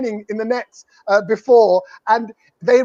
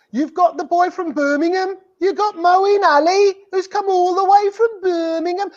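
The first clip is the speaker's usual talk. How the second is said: put-on Birmingham accent; 8 semitones higher than usual. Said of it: "that's how people from Birmingham talk".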